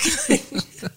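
high burst of laughter. Real, not acted. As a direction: laugh at your own joke. Laughing after her joke.

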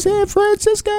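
very high sung-out city name; hype shout-out. Real, not acted. Cheese host hyping up the San Francisco shop, very high.

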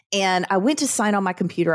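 ordinary talk

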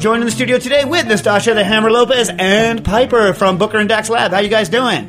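loud, high, rapid radio opening; show announcer. Real, not acted. Host hailing listeners to a live cooking show, full-force announcer.

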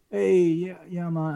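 half-hearted impression of a Jamaican lifeguard; imitated Jamaican lifeguard. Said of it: "the lifeguard comes up and he's like".

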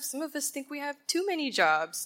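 ordinary talk, nothing marked

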